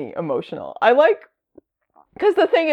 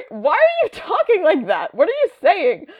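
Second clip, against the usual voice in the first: incredulous; 10 semitones higher than usual. Retold or imitated. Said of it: "mocking old comic dialogue".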